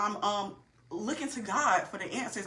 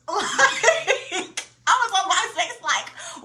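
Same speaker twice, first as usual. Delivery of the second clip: laughing loud and high through the words; 8 semitones higher, 7 dB louder, much slower than usual. Real, not acted. Laughing to viewers about her old MySpace days. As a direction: burst out laughing as you admit it.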